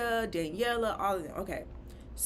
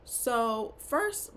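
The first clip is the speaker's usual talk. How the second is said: ordinary talk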